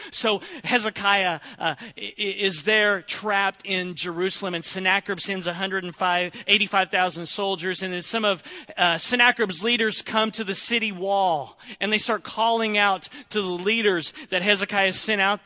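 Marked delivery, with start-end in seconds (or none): none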